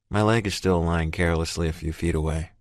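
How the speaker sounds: ordinary delivery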